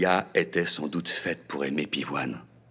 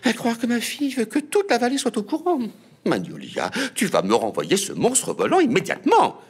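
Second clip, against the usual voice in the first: high, loud exasperated scold; high shrill-pitched mother scolding; 4 dB louder than usual. A narrator's reading. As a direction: order her daughter sharply.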